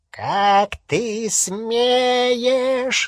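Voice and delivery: high, very slow strained growl; long, straining beastly roar; werewolf snarl. A narrator's reading. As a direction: drag it out as a furious snarl. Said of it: "зарычал он в ответ".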